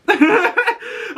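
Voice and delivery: real laugh, high and bright, falling away; high burst of laughter. Real, not acted. Vlogger laughing at the banter, real high laugh. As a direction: crack up at the joke.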